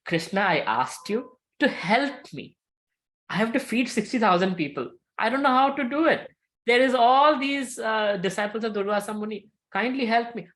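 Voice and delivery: high, worked-up plea for help; overwhelmed woman begging, acted out; acted pleading. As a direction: plead with rising urgency, pitched well up. Draupadi pleads with Krishna for help.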